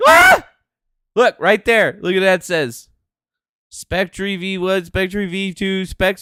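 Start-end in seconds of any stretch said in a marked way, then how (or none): 0.1-0.5 very high, loud yell of rage; frustrated scream. Real, not acted. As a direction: let out a sharp scream. Outburst at the news.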